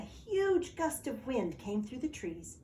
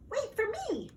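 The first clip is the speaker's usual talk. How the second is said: very high little mouse cry; mouse calling; 13 semitones higher than usual. Acted. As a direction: call out like a tiny mouse left behind. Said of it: "cried Mouse".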